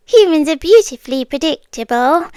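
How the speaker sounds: usual speaking voice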